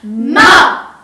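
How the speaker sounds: ma dropping sharply like a command; fourth tone ma. Shown as a demonstration. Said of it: "Let's try it out with the word ma".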